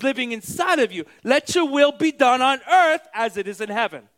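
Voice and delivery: emphatic, driving preacher; full-force preaching. Real, not acted. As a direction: preach it out with punched key words.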